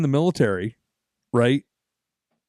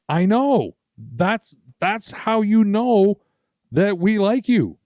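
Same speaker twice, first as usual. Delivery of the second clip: higher, slow, drawn-out point; slow knowing reassurance, pitched up; knowing emphasis; 7 semitones higher, 3 dB louder, much slower than usual. Retold or imitated. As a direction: spell it out for him slowly. Explaining they tease because they like.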